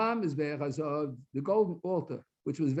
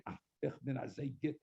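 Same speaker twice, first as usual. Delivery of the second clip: mock boast, muttered; 5 semitones lower, 10 dB quieter, faster than usual. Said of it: "they went around saying".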